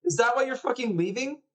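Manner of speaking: put-on high, accusing boy's voice; upset little brother. Little brother learns his sick sister is leaving, accusing. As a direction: confront her, pitch way up.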